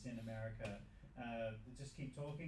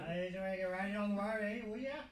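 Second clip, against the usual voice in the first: loud, high hammed-up Australian accent; louder ocker Australian bloke voice; mock Aussie accent; 8 semitones higher, 9 dB louder than usual.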